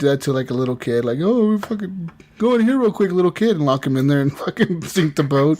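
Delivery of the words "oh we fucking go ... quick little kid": higher-pitched playful coaxing; mock luring a kid. Acting out luring a little kid.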